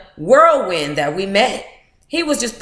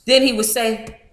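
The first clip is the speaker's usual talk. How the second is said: ordinary talk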